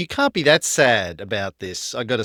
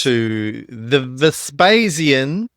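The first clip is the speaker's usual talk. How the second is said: loud, bright show opener; podcast host intro; 5 dB louder, slower than usual. Acted. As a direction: welcome the listeners like a host.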